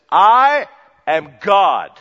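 very loud and slow I am God; loud slow declaration. Acted. Jesus announcing himself to his hearers, loud and slow. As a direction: announce it with full weight. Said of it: "he straight up says".

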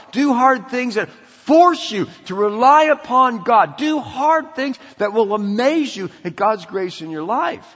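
loud, high-pitched, driving exhortation; impassioned preaching. Real, not acted. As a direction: drive each command home with punchy, forceful stresses. Preacher exhorts at full force.

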